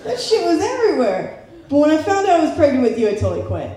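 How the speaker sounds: high, casual mom bragging about her wild past; mom's voice. Retold or imitated. Comic retelling the mother's answer in a high put-on voice. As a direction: toss it off like an old party girl. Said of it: "And she's like".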